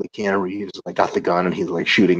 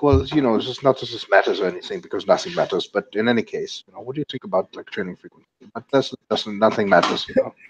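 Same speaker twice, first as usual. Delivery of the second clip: put-on offhand, nihilistic Swiss European accent; mock Swiss accent. Seminar attendee asking about training in Switzerland, mock Swiss accent.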